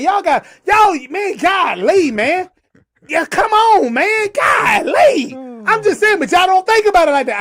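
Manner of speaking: high, pushed exclamations; fed-up plea, voice shooting up; exasperated. Real, not acted. Exasperated with the audience.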